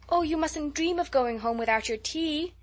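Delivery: distressed cry. Acted. Hostess begging her friend not to leave, distressed cry. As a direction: protest anxiously, voice up high. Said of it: "cried Anne in distress".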